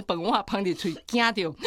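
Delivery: normal talking voice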